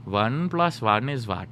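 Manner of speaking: put-on slow, drawn-out Indian-accented tutor; mock Indian accent, slow and deliberate. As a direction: imitate an Indian tutor, spacing out the words. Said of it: "That's how he used to talk".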